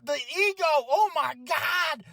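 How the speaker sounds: high, slow stunned gasp; shocked. Real, not acted. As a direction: gasp in disbelief.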